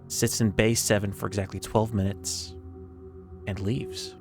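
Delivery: ordinary delivery, nothing marked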